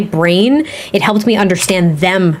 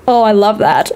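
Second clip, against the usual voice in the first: usual speaking voice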